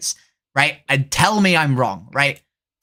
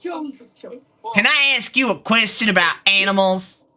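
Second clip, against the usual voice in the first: hammed-up Texas accent, lightly done; a Texan playing it up, drawling the vowels; 3 semitones higher than usual. Streamer performs a Texas accent for chat, hammed-up. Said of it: "You want me to do a Texas accent?".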